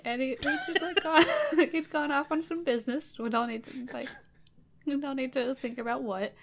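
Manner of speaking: high, giggly, flustered princess; flustered laughing excuse. Acted. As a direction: laugh nervously through the excuse. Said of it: "So she's like".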